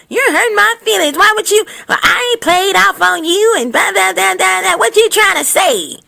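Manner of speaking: high, whiny offended protest; mock hurt woman. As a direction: whine it as if deeply insulted. Voicing mad woman refusing test.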